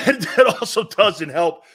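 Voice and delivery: chuckling through the words; laughing. Real, not acted. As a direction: laugh while saying it. Laughing at his own remark.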